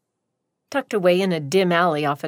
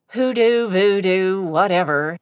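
dismissive shrug; 5 dB louder than usual. Acted. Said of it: "she shrugged".

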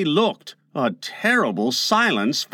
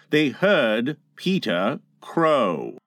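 plain reading voice

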